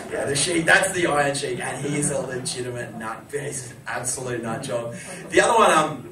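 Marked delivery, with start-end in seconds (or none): none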